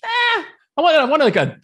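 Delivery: high playful mock-casual quote; mock nonchalance. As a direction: toss it off lightly, mocking the choice as easy. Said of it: "and then you're like".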